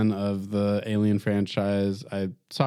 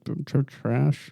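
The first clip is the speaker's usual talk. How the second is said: stammered, unsure verdict; tentative, halting delivery; hesitant; 5 semitones higher, slower than usual. Real, not acted. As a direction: stumble reluctantly into the word. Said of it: "say it with confidence".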